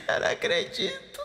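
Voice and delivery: high squealing laugh through the words; laughing disbelief. Real, not acted. Gamer laughs in disbelief at teammate's death.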